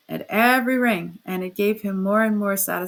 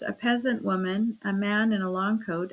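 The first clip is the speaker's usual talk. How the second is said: ordinary delivery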